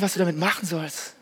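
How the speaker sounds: ordinary delivery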